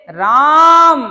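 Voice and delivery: राम called loud and long across a distance; calling out. Shown as a demonstration. Teacher calling राम from far off to show a pluta vowel. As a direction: call राम out, holding the vowel. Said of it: "जब हम किसी को आवाज देते हैं".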